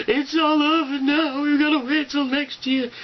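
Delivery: hammed-up sad lament; melancholy moan. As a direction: whine it like the party's over. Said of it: "kind of melancholy going on".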